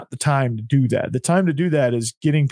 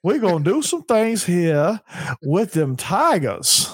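slow, higher hammed-up Southern drawl; mock Southern coach; 3 semitones higher, slower than usual. Co-host mocking the new coach's fake Southern speech. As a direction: play the coach faking a Southern accent. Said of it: "I'm Brian Kelly".

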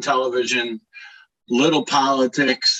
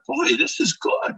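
pleasantly surprised; 13 semitones higher than usual. Retold or imitated. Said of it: "I said".

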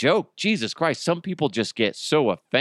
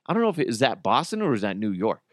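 usual speaking voice

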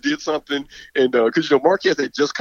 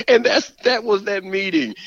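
ordinary delivery

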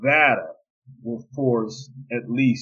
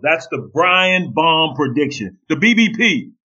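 announcing; 3 semitones higher, 6 dB louder than usual. Real, not acted. Announces his branded pick.

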